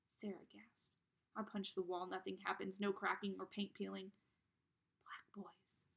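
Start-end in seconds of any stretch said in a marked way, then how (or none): 5.1-5.9 hushed unvoiced whisper. A narrator's reading. Girl whispers in horror at the window. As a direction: breathe it out in shock. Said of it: "Sarah whispers".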